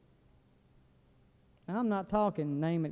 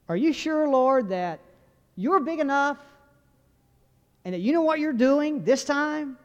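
higher-pitched, insistent challenge to God; doubtful questioning; 6 semitones higher, 4 dB louder than usual. Acted. Preacher voicing a believer's doubts to the Lord, insistent questioning. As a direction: press the doubt, voice raised.

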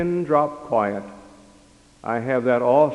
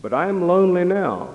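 put-on child's plea; 7 semitones higher, 3 dB louder than usual. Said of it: "After a while".

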